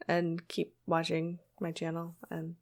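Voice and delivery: soft outro trailing away unfinished; fading out. Pretend. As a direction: let the sign-off fade away softly. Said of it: "like, fading out kind of thing".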